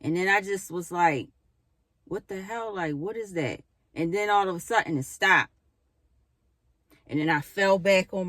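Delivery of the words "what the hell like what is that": soft, puzzled under-the-breath question; quietly bewildered; hushed disbelief. Retold or imitated.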